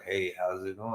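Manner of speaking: very low sultry greeting; sexy low voice. Retold or imitated. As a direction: drop deep and sexy. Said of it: "that sexy voice".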